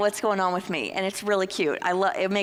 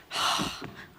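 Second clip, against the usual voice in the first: reluctant sigh; 5 dB quieter than usual. Retold or imitated. Reluctant partner dragging to talk.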